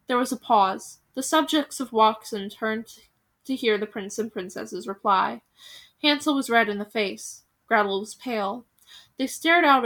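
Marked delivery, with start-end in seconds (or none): none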